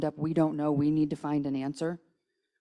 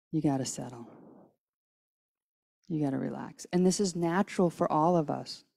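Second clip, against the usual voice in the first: ordinary talk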